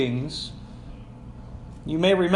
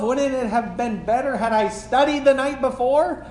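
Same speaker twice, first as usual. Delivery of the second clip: usual speaking voice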